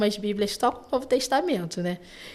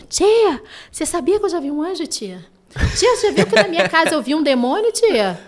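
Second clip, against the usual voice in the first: put-on high, fast, breathless child chatter; eager kids; 5 semitones higher, 4 dB louder than usual. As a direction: imitate kids rushing to tell the teacher. Excited children telling stories.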